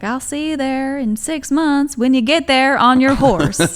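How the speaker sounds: put-on cowboy Southern accent. Guest retells comedy bit, hammed-up Southern cowboy accent. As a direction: play up a Southern cowboy's twang. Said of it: "He's like".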